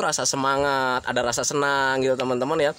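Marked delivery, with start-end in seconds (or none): none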